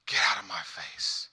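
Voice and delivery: low, whispered dismissal; harsh whisper pushing someone away; whispered rejection. Acted. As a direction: hiss it under your breath, coldly. God imagined rejecting us, retold hushed.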